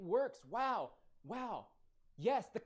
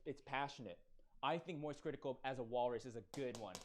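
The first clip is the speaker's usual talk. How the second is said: ordinary talk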